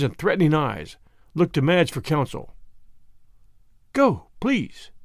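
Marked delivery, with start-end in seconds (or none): 3.9-4.9 begging. A narrator's reading. Wife urging the brother to go, begging. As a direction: beg him gently to leave. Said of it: "she begged".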